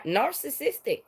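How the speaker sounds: ordinary talk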